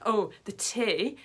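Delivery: normal talking voice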